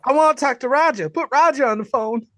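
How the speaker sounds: high, loud, pushy radio voice; mock radio caller. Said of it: "imitating Ray".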